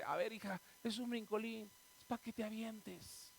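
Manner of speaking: en voz baja, quitándole drama; papá paciente. Acted. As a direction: explica bajito a la hija algo obvio. Papá imitado responde a la hija. (padre que minimiza, suave)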